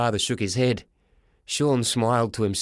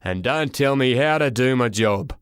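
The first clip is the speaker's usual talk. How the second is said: mimicked surly porter; booming man's voice. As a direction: boom it like an angry official. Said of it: "a great big booming voice".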